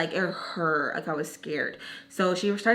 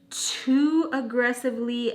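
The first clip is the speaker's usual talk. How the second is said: "too aggressively" slowed right down; drawn-out emphasis; 3 semitones higher, slower than usual. Real, not acted. Confessing how she washed the cup, "too aggressively" drawn out slowly. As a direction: stretch "too aggressively" for effect.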